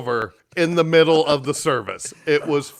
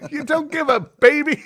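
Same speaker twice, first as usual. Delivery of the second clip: retold scolding; 12 semitones higher, 4 dB louder than usual.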